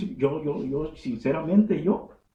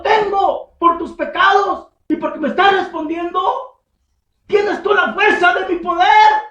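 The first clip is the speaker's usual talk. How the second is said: much higher, louder thundering shout; God's shouted challenge; 13 semitones higher, 10 dB louder than usual. Acted. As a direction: thunder the question at them. Voicing God in shouted challenge.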